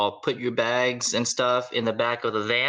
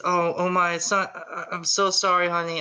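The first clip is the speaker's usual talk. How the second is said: apologetic mom; 8 semitones higher than usual. Acted.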